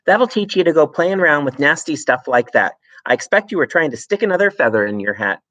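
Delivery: wife scolding her husband about the glue. Acted. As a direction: lecture him with scorn. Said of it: "Missus Wormwood said to him".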